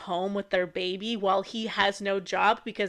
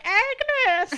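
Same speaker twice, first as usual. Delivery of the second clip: eerie killer call; 14 semitones higher than usual. Retold or imitated.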